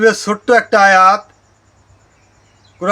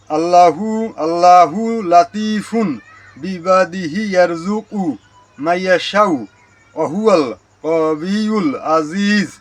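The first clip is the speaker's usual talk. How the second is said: slow careful recitation. Shown as a demonstration. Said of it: "বাংলায় দীর্ঘি দেওয়া থাকলে".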